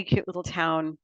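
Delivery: normal talking voice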